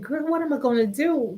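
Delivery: lightly acted, familiar appeal; retold girl talk. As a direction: retell a plea to a close confidante. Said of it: "that's how we would talk".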